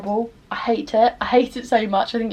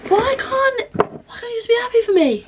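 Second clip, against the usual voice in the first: exasperated plea; 13 semitones higher than usual. Real, not acted. Ranting about commenters who can't be happy for her, high exasperated whine. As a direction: throw your hands up at them, voice climbing high.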